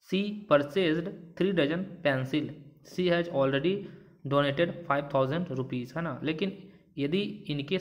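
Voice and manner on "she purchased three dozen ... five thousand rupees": very slow, word by word example reading; slow dictation. Shown as a demonstration. Teacher dictates an example to the class, very slow. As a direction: read the sentence slowly so students follow. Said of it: "teacher reads example sentences".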